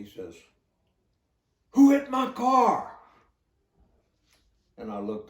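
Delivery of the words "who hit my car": retold furious yell, much higher and louder. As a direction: bellow it like an outraged car owner. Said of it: "the guy said very loudly".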